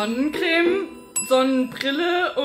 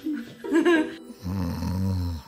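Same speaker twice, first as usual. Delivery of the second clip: low chuckle; a suppressed laugh; stifled giggles. Real, not acted. Laughs at own answer.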